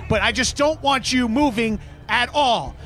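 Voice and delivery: usual speaking voice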